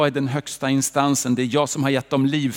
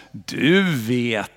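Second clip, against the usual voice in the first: dripping irony; 6 semitones lower than usual. Retold or imitated. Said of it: "det dryper av ironi".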